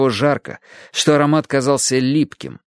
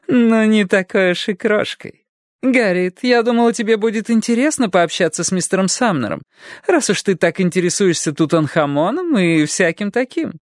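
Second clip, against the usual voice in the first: put-on playful old lady; 9 semitones higher than usual. A narrator's reading. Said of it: "игриво рассмеялась". (кокетливая пожилая дама)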